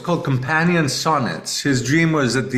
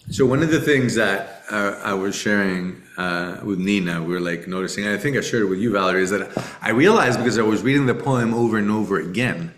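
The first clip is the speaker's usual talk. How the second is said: ordinary talk, nothing marked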